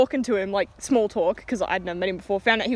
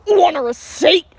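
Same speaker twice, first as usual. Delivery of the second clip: loud, raised imitation of a hostile customer; hammed-up belligerence; mock aggressive question; 5 semitones higher, 5 dB louder, slower than usual. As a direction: throw it out like a tough guy. Angry customer acted out.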